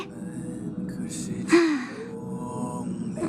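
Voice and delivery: soft, breathed-out sigh; wistful sigh. Acted. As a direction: let out a long, quiet sigh. Mourning the missing knight.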